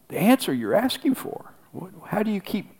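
normal talking voice

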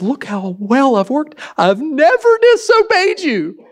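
slow, high, drawn-out whine; mocking smug son. Preacher mimicking the older son to the congregation, in a slow high whine. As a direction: stretch the boast out, high and slow. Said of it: "this is what this son says".

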